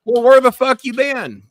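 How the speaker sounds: high, loud exclamation of mock outrage; loud demand. Real, not acted. Host to a long-absent regular, loud demand. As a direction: burst out loudly, high-pitched.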